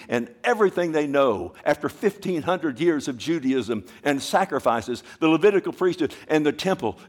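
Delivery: loud impassioned preaching; preaching at full force. Acted. Preacher explaining to the church, fervent. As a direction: press the point hard.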